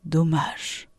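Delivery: low, soft sigh of regret; quietly crestfallen; sighing disappointment. A narrator's reading. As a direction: let the word sink with a sigh. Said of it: "Il soupira".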